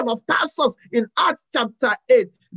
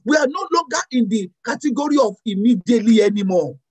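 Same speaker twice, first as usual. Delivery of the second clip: normal talking voice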